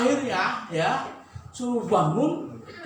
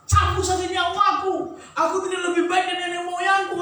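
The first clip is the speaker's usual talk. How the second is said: angry plea to God; 9 semitones higher than usual. Acted. Elijah angrily begging God to take his life, shouting. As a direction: shout it like a prophet who wants to die. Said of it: "Marah sama Tuhan".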